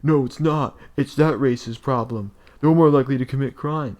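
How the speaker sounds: high, loud, slowing sneer; mocking opponent voice. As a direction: parody the other side. Parody of opposing arguers.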